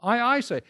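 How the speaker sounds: doubled I, pitched high; emphatic I. Shown as a demonstration. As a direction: lean hard on I, I. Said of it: "It's an emphatic".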